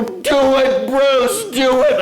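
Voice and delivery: high loud cheering chant; mock yelling. Campers across the channel egging Bruce on, high loud chant. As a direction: egg him on from across the channel. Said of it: "they're yelling at".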